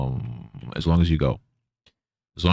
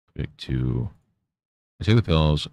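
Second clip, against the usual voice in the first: ordinary talk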